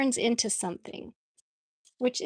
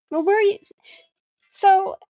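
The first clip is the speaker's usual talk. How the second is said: excited; 10 semitones higher, 7 dB louder, faster than usual. Real, not acted. Calling out to absent viewer.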